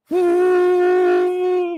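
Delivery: high, loud whoop; excited whoop. Pretend. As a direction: let out a loud joyful woo. Imagined salon hair dryer.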